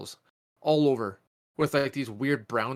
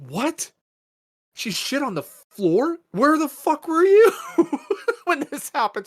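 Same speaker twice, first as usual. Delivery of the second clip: much higher, louder, slowed shout breaking into a laugh; retold furious disbelief; 10 dB louder, slower than usual. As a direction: replay the outraged yell at the wife. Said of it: "I'm like".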